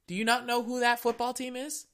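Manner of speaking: high, exasperated disbelief; high incredulous hello; incredulous retort. Retold or imitated. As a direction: throw it back at them, high and baffled. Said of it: "I'm like".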